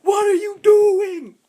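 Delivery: high, loud, drawn-out outrage; imitated driver yell. Drivers yelling at wrong-way teen, loud mock outrage. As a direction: holler it slowly, stretching each word.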